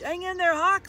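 high, loud father frog plea; anxious urging. A narrator's reading. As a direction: urge loudly, afraid of being dropped. Father fears falling.

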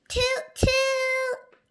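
high, loud playful toots; copying boat horns. Acted. Danny and the dinosaur tooting back at the boats, high.

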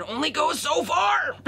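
high, strained yell building at the end; exasperated shout. Real, not acted. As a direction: lose your patience and yell it out. Losing patience with lawnmower noise.

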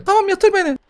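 a tiny brat swearing; squeaky baby voice. Pretend. Gamer voices the demon baby cursing him, squeaky.

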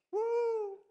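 high, loud whoop; a hammed-up, high, loud woo; mock cheer. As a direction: celebrate too early in jest. Mock cheer after naive prayer.

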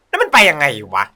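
ordinary delivery

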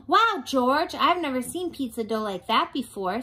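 high, bright amazement; amazed. A narrator's reading. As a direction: gasp it out in wonder. Girl amazed at dough.

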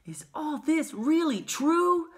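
put-on high, slow, wondering child voice; wide-eyed boy. A narrator's reading. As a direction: ask like an awestruck little boy. Wide-eyed boy questions his grandfather.

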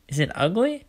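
high questioning little-sister voice; inquisitive Olive. A narrator's reading. Olive asks about the hidden necklace, high and curious. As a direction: pitch it up, curious.

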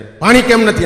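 barked high and loud at the household; angry shout. Retold or imitated. Retired army man shouting at his wife for water. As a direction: yell it like a hot-tempered ex-soldier. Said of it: "રાડા રાડ કરો માં તમે".

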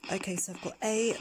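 whispering; hushed whisper, breath only; leaning in to whisper. Real, not acted. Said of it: "I'm gonna whisper so you can hear".

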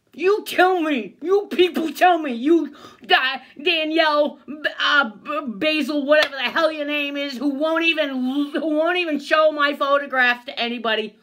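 loud, high, ranting accusation; real anger. Real, not acted. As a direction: demand answers from people who failed you. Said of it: "I'm so angry".

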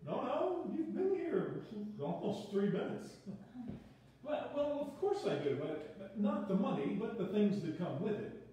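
deadpan host, lightly voiced; dry composer. Vernon teasing, pitch up.

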